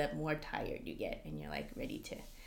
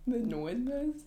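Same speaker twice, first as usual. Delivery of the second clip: put-on questioning try at a Northerner accent; mock Northerner accent, higher, testing the sound; 6 semitones higher than usual. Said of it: "Is that how they say it?".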